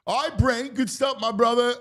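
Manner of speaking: high, loud hype-man praise; hyped sign-off. Real, not acted. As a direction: send him off with big energy.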